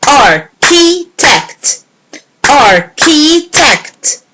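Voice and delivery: slow, syllable by syllable: Ar-chi-tect; counted syllables. Shown as a demonstration. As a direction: beat out Architect. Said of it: "Let's see architect".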